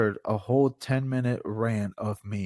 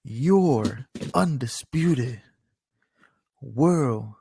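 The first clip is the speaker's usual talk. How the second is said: slow, drawn-out title announcement; ring announcer; 2 semitones higher, 5 dB louder, much slower than usual. Pretend. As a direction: stretch each word like a ring announcer, louder. Performs mock ring announcer introduction.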